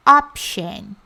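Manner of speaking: Option said slowly, OP-shon with a clear sh; teacher modelling the word Option; model pronunciation. Shown as a demonstration. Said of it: "Opção em inglês".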